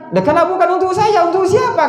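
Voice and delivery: the official can't believe the palace isn't his; indignant question. Acted. Official asks in dream, indignant.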